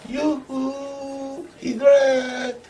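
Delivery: high, drawn-out yoo-hoo call; singsong calling. Acted. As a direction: call your sweetheart in a long high coo. Igor calls back to girlfriend.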